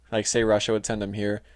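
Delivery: usual speaking voice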